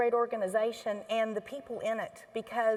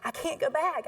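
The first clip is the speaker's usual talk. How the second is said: higher and rushed, voice breaking; retold crying; 5 semitones higher, faster than usual. Telling her husband at home, half-tearful and rushed. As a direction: blurt it out as if near tears. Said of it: "start crying and say".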